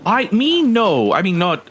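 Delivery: lightly voiced satyr, high and stumbling; flustered satyr. Game master's satyr answers in character.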